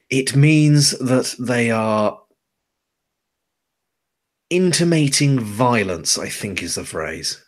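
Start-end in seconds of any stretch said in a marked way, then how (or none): 0.1-6.2 weary explanation. Acted. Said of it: "runs a hand over her face and goes".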